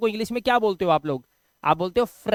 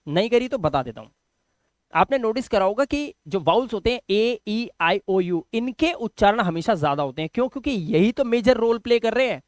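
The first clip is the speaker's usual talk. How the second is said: ordinary talk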